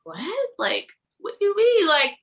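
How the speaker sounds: high-pitched mock disbelief; incredulous. Woman replaying her reaction to a body compliment, incredulous. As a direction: squeal it in comic protest, rushing the end.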